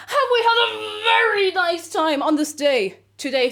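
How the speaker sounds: high, loud, slow mock wail. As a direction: wail it out dramatically. Big question asked theatrically.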